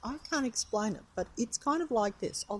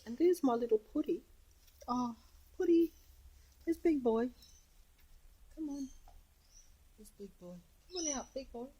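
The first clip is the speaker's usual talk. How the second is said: higher, sing-song calling to the cat; coaxing pet talk; 5 semitones higher than usual. Real, not acted. Owner calling her cat Putty out from the door, high coaxing pet talk.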